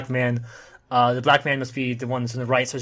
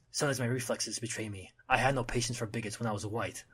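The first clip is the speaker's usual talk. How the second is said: soft, understated brooding voice-over; inner monologue; 3 semitones lower, 7 dB quieter than usual. Acted. The Punisher thinking to himself, soft inner monologue. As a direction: think it to yourself, quietly. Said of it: "Punisher thinks himself".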